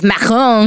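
macaron said the French way; French pronunciation. Shown as a demonstration. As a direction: pronounce macaron with a French accent. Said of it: "Oh God, you did it again".

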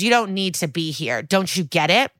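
ordinary talk

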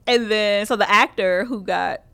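ordinary delivery

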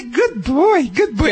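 high, sing-song praise; cheerful dog praise. Shown as a demonstration. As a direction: lift it up like praising a puppy. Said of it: "And I will say".

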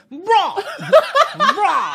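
high mock yell of bro. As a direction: bray it out like noise. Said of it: "why he's yelling".

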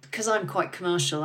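usual speaking voice

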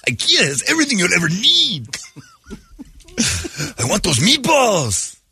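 high, slowed Swedish lilt; mock Swedish accent. Swedish adoptee craving meatballs, high mock Swedish lilt. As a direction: swoon over Swedish meatballs.

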